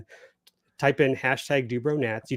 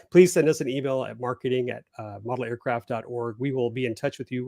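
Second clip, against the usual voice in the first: usual speaking voice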